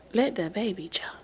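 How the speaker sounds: whispered aside. Real, not acted. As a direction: drop to a whisper. Aside after mother quote.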